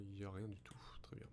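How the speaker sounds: soft unvoiced whisper. Real, not acted. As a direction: whisper while searching. Searching quietly.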